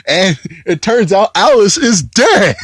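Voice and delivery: high, swooping amazement; incredulous reveal. Real, not acted. Reviewer revealing that Alice is dead, high amazed delivery. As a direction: build to a high, disbelieving last word. Blurts shocking twist, laughing after.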